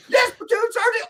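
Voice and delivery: very high, loud obedient squeal; mock recruit's shouted yes. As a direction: yell the answer like a cornered recruit. Recruit shouts back to sergeant.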